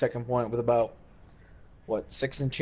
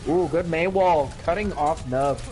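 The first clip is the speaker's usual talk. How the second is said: excited; 5 semitones higher, 4 dB louder than usual. Real, not acted. Caster reacts to a big play.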